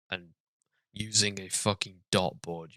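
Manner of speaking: usual speaking voice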